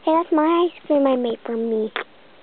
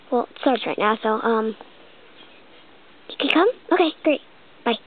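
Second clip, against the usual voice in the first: ordinary talk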